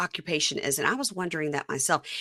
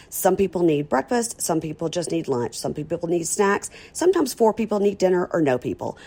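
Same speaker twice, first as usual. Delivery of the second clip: ad read; 3 dB louder than usual. Acted. Sponsor ad read.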